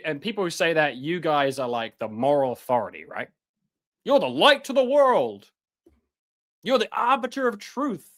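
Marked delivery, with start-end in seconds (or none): 4.1-8.1 higher, sneering mock flattery; mock them with fake admiration; sarcastic praise. Sarcastic address to critics.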